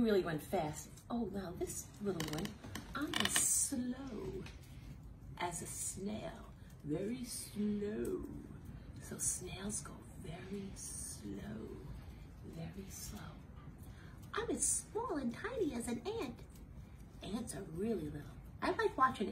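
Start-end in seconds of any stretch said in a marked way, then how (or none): 3.0-8.2 drawn-out, very slow delivery; slow and sleepy snail. Acted. 9.0-13.2 softer and much slower, fading to a whisper; slow hush. Acted. Explaining snails to children. 14.3-16.4 high, small squeaky voice; little high ant voice; tiny ant voice. Acted.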